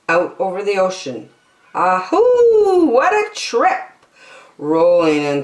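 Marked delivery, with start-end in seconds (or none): 1.7-3.9 high whooping call of delight; excited cry. A narrator's reading. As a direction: whoop as the ship rolls. Excited sea voyage cry.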